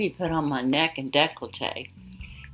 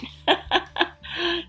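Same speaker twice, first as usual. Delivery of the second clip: a bright, high giggle of delight; short high-pitched giggle; high delighted laugh; 13 semitones higher than usual. Real, not acted. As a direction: laugh with real pleasure at the cute stickers. Laughs at the card.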